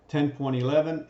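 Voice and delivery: usual speaking voice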